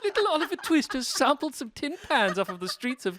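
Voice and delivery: high, giggling storytelling; laughing. Real, not acted. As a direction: tell it while cracking up. Laughing joke about sampling.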